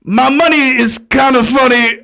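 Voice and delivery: put-on raspy old lady voice. Retelling the confused stranger's words for friends, raspy. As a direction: replay her rambling in a raspy put-on voice. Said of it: "So one more time".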